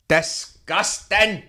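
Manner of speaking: disgust. Real, not acted. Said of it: "drawn-out outrage".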